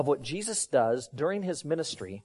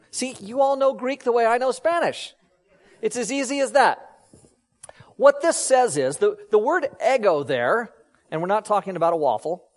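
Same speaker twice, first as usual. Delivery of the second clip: ordinary delivery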